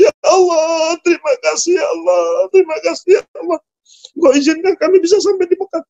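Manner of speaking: high, sobbing prayer, fading then rushed; weeping thanks. Acted. Mother thanks God on reaching Mecca, weeping. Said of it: "ibunya nangis".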